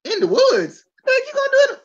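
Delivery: high, incredulous re-enactment; retold disbelief. Host retelling a reaction to the announcement, high-pitched disbelief. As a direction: climb into a high squeal of mock bafflement.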